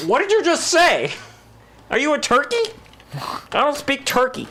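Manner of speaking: very high-pitched, incredulous squawk; mock bafflement. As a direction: squeal it in comic disbelief. Mock reply to the gibberish.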